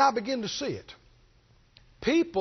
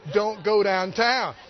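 slow, deliberate command; slow firm warning; 2 semitones lower, slower than usual. Retold or imitated. Holy Ghost warning a believer, slow and firm. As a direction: spell out the warning word by word.

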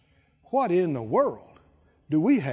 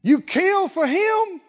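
higher, rising into a mocking wail; jealous elder son; 5 semitones higher, 4 dB louder than usual. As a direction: spit out the brother's sins with envy. Elder son's jealous outburst.

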